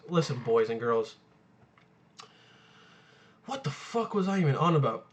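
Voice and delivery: ordinary talk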